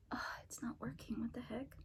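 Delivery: hushed annoyed whisper; frustrated whisper. Real, not acted. Girl whose piercing gun jams, frustrated whisper. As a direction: whisper it, puzzled.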